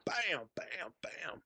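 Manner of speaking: soft, high punch sound effects; hushed mimicked impacts; imitated punches. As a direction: act out quick blows in an undertone. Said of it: "I just start doing this".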